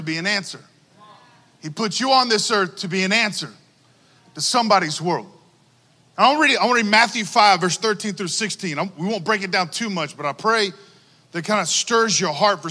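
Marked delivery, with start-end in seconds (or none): none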